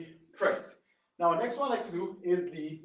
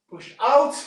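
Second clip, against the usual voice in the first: loud, punchy coach cue; coaching shout; 6 semitones higher, 10 dB louder than usual. Real, not acted. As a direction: bark the cue to drive the rep. Coach cueing a rep.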